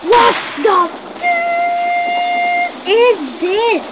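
high, slow, drawn-out disbelief; shocked. Real, not acted. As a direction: gape at the screen in shock. Shocked at game.

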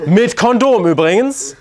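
slow, high and loud aside; slow loud pointed aside; emphatic aside. Real, not acted.

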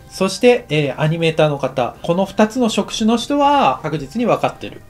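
ordinary talk, nothing marked

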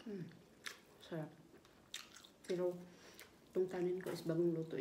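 speaking around food; muffled chewing speech; mouth full, chewing. Real, not acted. Seen 2: Food put in mouth at -4; lips chewing through labelled words.

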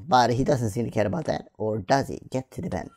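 usual speaking voice

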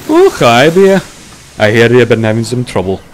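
booming friendly arrival; hearty engine greeting. A narrator's reading. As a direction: call out a big hello. Douglas greets Bear on arrival.

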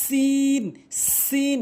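সিন with sharp s and long "ee"; tongue tip to teeth, stretch সিন to "seen"; correct সিন. Shown as a demonstration. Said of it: "এভাবে উচ্চারণ করবেন".

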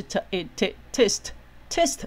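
"t-, it-, t-, test": separate sounds slowly built into one syllable; sounds blended. Shown as a demonstration. Teacher joining a vowel to the consonant before it, slow build-up to "test". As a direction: sound out the "t", add the vowel, then land on "test". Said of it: "拼成一个音".

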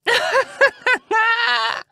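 very high, loud evil cackle; mocking witch cackle. A narrator's reading. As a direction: cackle in his face like a witch. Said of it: "mocking him".

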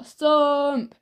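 high, drawn-out "thump" sound effect; acted thump. A narrator's reading. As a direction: voice the blow on the door. Acting out blows on door.

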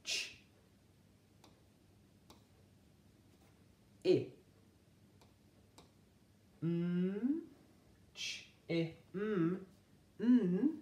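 Ch- i m- n- e, each sound said alone and clipped apart; a phonics teacher segmenting for children; segmenting phonemes. Shown as a demonstration. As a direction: sound out "Chimney" one phoneme at a time. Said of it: "that first phoneme I heard was".

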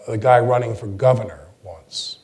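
ordinary talk, nothing marked

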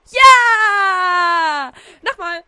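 loud, high victory yell; real cheer of relief; triumphant cheer. Real, not acted. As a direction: shout it out like you just won. Cheering after clearing the level.

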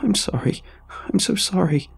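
high, frantic pleading sorry; panicked apology. Acted. Kid apologizing to the monster deer, panicked. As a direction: beg forgiveness in terror.

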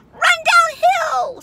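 very high, loud, drawn-out call; stretched high shout; cows calling advice. A narrator's reading. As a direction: holler it long like a cow across a field. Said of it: "suggested the cows".

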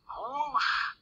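high, airy whoosh of wind. A narrator's reading. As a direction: blow out a long whoosh. Narrator imitates the blowing wind.

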